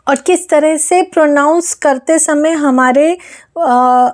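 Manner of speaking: ordinary talk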